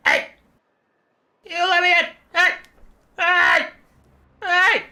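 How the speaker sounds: high, loud bird-demon yelling; shouting to be let in. Acted. Malagor demands the elves open up, high frantic yelling. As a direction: yell up at the tower, high and loud.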